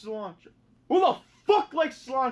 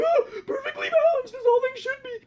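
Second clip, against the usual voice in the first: much higher, strained, half-laughing villain voice; Thanos impression; 12 semitones higher than usual. Thanos on his throne, hammed-up and squeaky. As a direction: overblown villain line, cracking up. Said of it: "And he's like".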